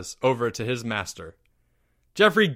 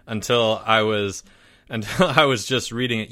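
plain reading voice

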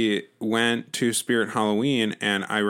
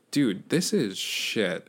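slow disgust; much slower than usual. Retold or imitated. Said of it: "I was like".

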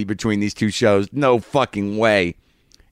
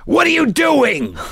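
high, slow squeaky raccoon snarl; angry raccoon voice; slower than usual. Pretend. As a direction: snap it out like an angry cartoon raccoon. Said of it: "You want me to do it for you?".

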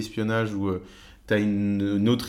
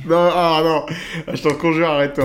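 mock pleading; 7 semitones higher, 6 dB louder than usual. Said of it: "begging him to stop the voice".

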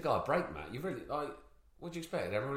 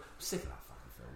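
whispered, fed-up aside; muttered aside; 11 semitones lower, 14 dB quieter than usual. Real, not acted. Dad fed up with the film, whispering under his breath. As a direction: drop to a hushed grumble.

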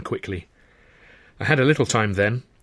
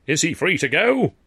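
theatrical; 8 semitones higher, 3 dB louder than usual. Acted. Sid addressing constable.

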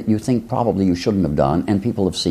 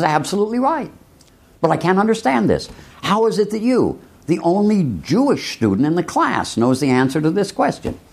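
teacher voice; 9 semitones higher than usual. Acted.

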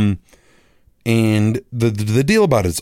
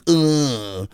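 disgusted groan; 3 semitones higher than usual. Retold or imitated. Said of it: "mine's like".

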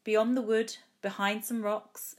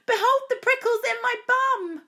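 very high, loud wail for mum; child's high-pitched shriek of distress; anguished shout; 8 dB louder than usual. A narrator's reading. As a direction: yell it out high in full alarm. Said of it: "I shouted".

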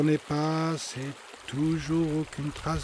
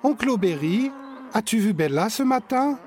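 put-on higher, louder child voice; asking child; 9 semitones higher, 6 dB louder than usual. Boy asking his uncle about the dog, higher. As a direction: ask your uncle politely. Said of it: "demande Victor".

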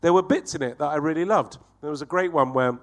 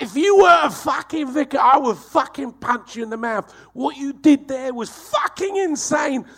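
angry cabbie; 11 semitones higher, 5 dB louder than usual. Retold or imitated. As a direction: yell it right in their face. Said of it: "He's so angry".

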